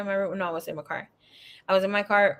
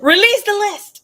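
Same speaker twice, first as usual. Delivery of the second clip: higher, louder mock decree; hammed-up command, higher and louder; 11 semitones higher, 8 dB louder than usual. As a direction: proclaim it like a royal order. Joking command, names list.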